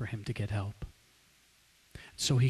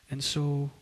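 ordinary talk

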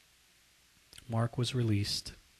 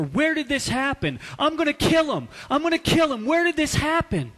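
raging shout, much higher and louder; furious yelling; 8 dB louder than usual. Acted. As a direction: yell it like you want to kill him. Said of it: "he was furious".

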